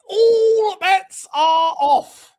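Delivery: high, loud, very slow "All bets are off"; drawn-out proclamation. Real, not acted. Repeats it drawn out.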